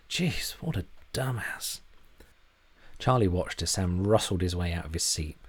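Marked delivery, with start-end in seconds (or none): 0.1-1.8 slow, drawn-out exasperated scoff; scornful aside. A narrator's reading. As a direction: sigh it out with contempt. Charlie's inner scorn.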